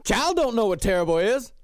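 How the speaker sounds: lightly put-on protesting voice; Charles Manson. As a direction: imitate an evasive interviewee. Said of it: "He's like".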